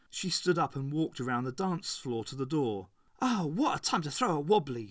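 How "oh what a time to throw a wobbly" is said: ordinary delivery, nothing marked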